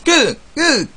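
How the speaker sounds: high shouted game character call; imitating game character shout. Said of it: "점프할 때마다 끝이라고 외치는".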